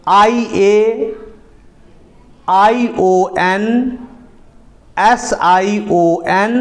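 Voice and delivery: letters drawn out one by one, very slow; spelled out slowly. Shown as a demonstration. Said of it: "एस के बाद". (अक्षर धीरे-धीरे बोलना)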